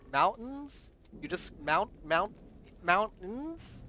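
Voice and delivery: mountain attempted with the t dropped, high and loud, nasal maʊn-style vowel; trying another accent. Shown as a demonstration. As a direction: strain to say mountain as "moun-in", pitching up as if asking. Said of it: "It feels like I'm choking".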